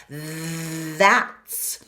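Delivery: "That's" with tongue between the teeth, bright vowel; voiced TH demo. Shown as a demonstration. Teacher modelling a voiced TH in "That's". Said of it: "It's a voiced T H".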